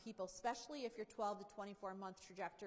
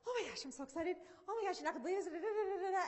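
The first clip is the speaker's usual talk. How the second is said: higher and louder, gushing "oh my gosh" to a friend; gushing excitement; 7 semitones higher, 6 dB louder, much faster than usual. Retold or imitated. Speaker acting herself gushing news to a friend, excited and much higher. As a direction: bubble over like you can't hold the news in. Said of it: "get so excited about things happening".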